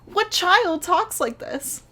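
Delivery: high, drawn-out incredulous question; exasperated disbelief. Real, not acted. Reviewer appalled at a child's dialogue, high slow disbelief. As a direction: stretch it out, utterly baffled.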